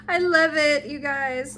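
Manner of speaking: high, loud burst of delight; thrilled. Real, not acted. Fan unboxing a special edition, loudly delighted. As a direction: blurt it out, overjoyed.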